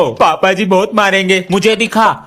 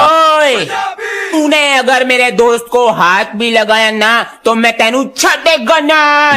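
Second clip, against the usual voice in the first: angry Punjabi threat; 4 semitones higher than usual. Acted. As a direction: square up to the bully in Punjabi. Sardar threatens bully.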